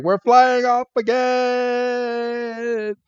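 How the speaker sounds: high cry with a very long-held last word; cartoon blast-off cry. Ducklets blasted into the sky, high drawn-out cry. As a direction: stretch it out high as if sailing away.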